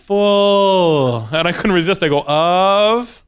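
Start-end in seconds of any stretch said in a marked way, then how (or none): 0.1-1.3 one long stretched-out word, mock teaching; patronizingly slow. Airline agent repeats herself to passenger, mock patronizingly slow. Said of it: "talking to me like I'm in the slow learning class". 2.3-3.2 one long drawn-out word with a questioning rise; mock slow sounding-out. Passenger can't resist playing along with agent, mock slow.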